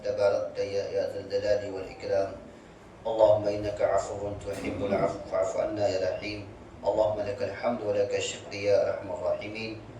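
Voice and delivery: low, steady dua recitation; prayer. Acted. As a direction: pray quietly and humbly over the gathering. Closing dua after lesson.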